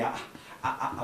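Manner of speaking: ordinary delivery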